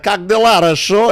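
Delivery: a hint of a Russian greeting, mocking; Russian accent. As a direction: bark it out like a Russian. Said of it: "it's like".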